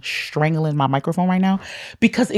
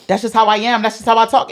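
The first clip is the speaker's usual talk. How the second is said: loud high demo; 6 semitones higher, 5 dB louder than usual. Shown as a demonstration. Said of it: "my octave goes a little higher".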